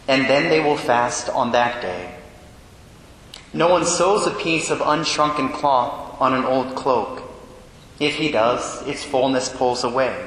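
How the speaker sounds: formal Gospel proclamation; cathedral echo. Acted. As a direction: reverent liturgical reading. Priest proclaims the Gospel at Mass.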